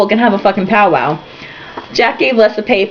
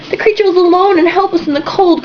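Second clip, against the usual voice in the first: dramatic lady; 8 semitones higher than usual. Acted. Woman scolding her boyfriend, high dramatic pleading. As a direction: plead grandly for the poor creature.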